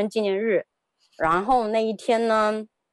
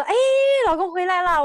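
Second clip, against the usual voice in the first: high, delighted "he's home" cry; re-enacted anticipation; 9 semitones higher than usual. Wife reacting to the door opening at last, excited. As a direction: act out hopeful excitement, pitched up. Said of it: "我就很期待".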